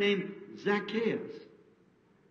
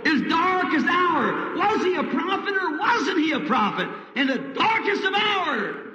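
preaching at full force; 11 semitones higher, slower than usual. Acted. Preacher builds to a climax over the darkest hour. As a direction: lift the congregation with each line.

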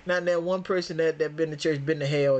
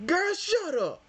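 put-on a higher, brushing-off girl shut up; mock dismissal; 12 semitones higher, slower than usual. Answering the lady in the clip, higher mock dismissal. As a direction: swat her words away.

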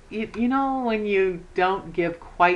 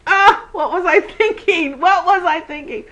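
self-mocking laugh; 9 semitones higher, 5 dB louder than usual. Real, not acted. Laughing at her own zucchini gift.

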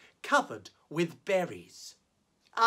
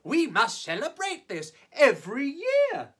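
another elephant; 6 semitones higher, 3 dB louder than usual. Acted. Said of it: "said another".

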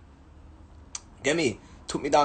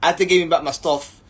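ordinary talk, nothing marked

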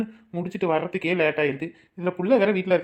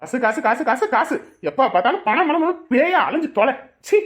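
money rant climbing higher; angry ranting; 4 semitones higher, 3 dB louder than usual. Acted. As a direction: hammer the repeated words, building in anger. Husband rails at wife about money. (கோபத்தில் குரல் ஏற ஏற புலம்பி)

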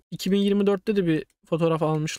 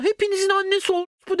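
taunting insult; 9 semitones higher, faster than usual. Retold or imitated.

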